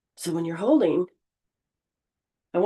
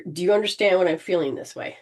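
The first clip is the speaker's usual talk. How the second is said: ordinary talk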